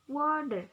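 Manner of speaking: "Water" with WA punched loud; stress on first syllable. Shown as a demonstration. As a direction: lean hard on the start of "Water", falling after. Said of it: "The stress at the beginning of a syllable".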